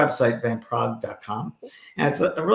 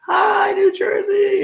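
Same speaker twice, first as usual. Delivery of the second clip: high, slow stage greeting shout; hyped-up hello to the crowd; excited shout; 5 dB louder, much slower than usual. Retold or imitated. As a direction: burst out onto the stage hollering. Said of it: "and I'm like".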